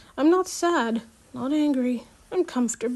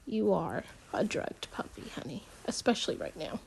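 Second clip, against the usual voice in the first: aside; 4 semitones lower, 12 dB quieter than usual. Real, not acted. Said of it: "reader's side comment".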